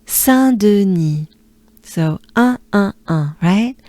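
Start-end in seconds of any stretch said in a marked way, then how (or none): 0.1-1.3 Saint-Denis drawn out slowly, nasal French vowel. Shown as a demonstration. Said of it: "We take a good breath".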